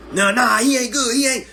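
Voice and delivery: high, loud dismissive scoff; know-it-all bettor. Retold or imitated. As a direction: wave him off like a sure pundit. Betting fan dismissing a player.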